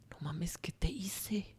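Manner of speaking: slow, despairing whisper. Retold or imitated. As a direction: breathe the question out in disbelief. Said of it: "en ese momento yo decía".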